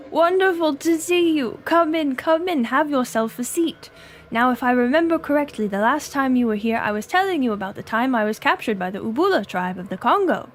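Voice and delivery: hammed-up British accent, hearty welcome. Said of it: "Should I attempt a British accent".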